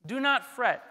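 ordinary delivery

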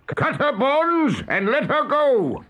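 high, slow commanding call; Hook imitation. A narrator's reading. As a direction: call the order as the pirate captain. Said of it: "he now imitated the voice of Hook".

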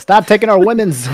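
high, loud comic protest; mock outrage. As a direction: imitate indignant villagers for laughs. Acts out angry villagers.

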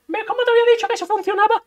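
high and fast boast; bragging inner voice. Acted. Inner voice brags to José about the combo. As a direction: crow about being right.

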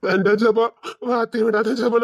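a sudden loud sob in the plea; crying plea. Acted. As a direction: beg her through tears, breaking. Friend refuses his love, he begs.

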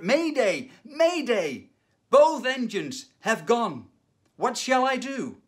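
high, loud, slow mayday cry; desperate shout. Retold or imitated. Pilot calling the tower with both engines gone, desperate shout. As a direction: yell for rescue at full alarm. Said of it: "This time he shouted".

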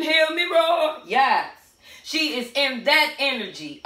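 normal talking voice

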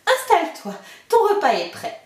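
put-on the giant's wife hurrying the boy away; ogre's wife warning. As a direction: shoo the child away with a nervous chuckle. Wife serves the ogre.

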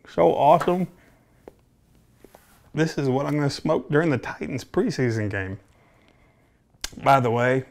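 talking around pipe stem; muffled between puffs; pipe in mouth. Real, not acted. Seen 2: Pipe held at his lips, smoke visible, through most frames.

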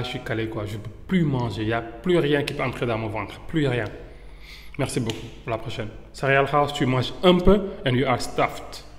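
normal talking voice

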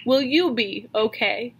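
ordinary talk, nothing marked

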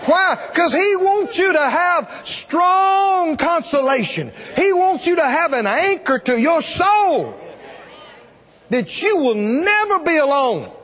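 high-pitched, sustained preacher's proclamation; full-force preaching. Real, not acted. Preacher promising congregation they're never alone, full-force preaching. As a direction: preach it hard, drawing out the key words.

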